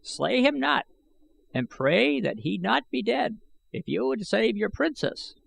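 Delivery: an ancient schemer speaking up; old man I Gos. A narrator's reading. Old man warning Gahan from the shadows, high and aged.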